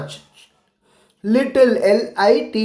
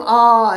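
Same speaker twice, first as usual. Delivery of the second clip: a short louder mock "aa" of pain; mock cry of pain; 4 dB louder than usual. Shown as a demonstration. Teacher showing children how we cry out, short louder mock yelp. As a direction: yelp like a kid who hurt his knee. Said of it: "ऐसे चिल्लाते हैं ना हम".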